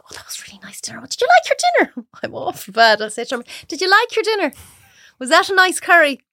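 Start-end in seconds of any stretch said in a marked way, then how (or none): 0.0-1.0 whispered aside, half-hearted; mock whisper. Telling the story of dinner at home, a mock whisper. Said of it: "she's doing the voices again". 1.0-2.1 much higher and quick, a playful squeak; high fast put-on voice. Wife fishing for praise of her dinner, high and quick. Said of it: "she's doing the voices again". 3.7-6.2 higher, eager question put on for fun; eager fishing voice. Wife asking Dermot about the curry, eager and higher. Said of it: "she's doing the voices again".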